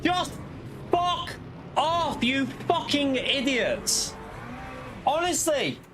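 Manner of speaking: high-pitched angry swearing; swearing tirade. Real, not acted. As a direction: rage at the other drivers. Crashed in race, raging at others.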